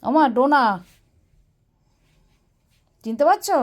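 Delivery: loud, high question; to someone hard of hearing. Real, not acted. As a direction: ask loudly so she hears.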